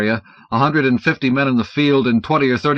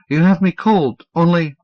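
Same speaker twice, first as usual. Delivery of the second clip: high, stammering frightened man; nervous stammer; 8 semitones higher, 5 dB louder than usual. Acted. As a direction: stutter it out high and anxious. Dunlop's stammering voice.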